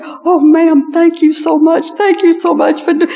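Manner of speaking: imitating the relieved wife; grateful young wife. Grateful wife thanks narrator.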